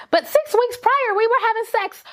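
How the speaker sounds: high, stunned retelling of her own reaction; shocked disbelief. Retold or imitated. Telling the listener how she reacted to her ex, high and incredulous. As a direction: let it leap up in disbelief. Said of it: "And I was like".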